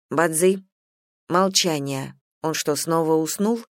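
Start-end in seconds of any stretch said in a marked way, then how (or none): none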